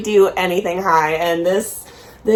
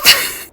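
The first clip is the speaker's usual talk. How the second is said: burst of laughter. Real, not acted. Laugh after hype.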